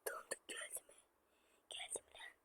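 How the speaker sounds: breathy whisper right into the mic. Pretend. Gamer whispering a teasing question to viewers. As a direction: hush it like a secret.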